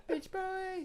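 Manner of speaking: high mock taunt. Echoing the taunt.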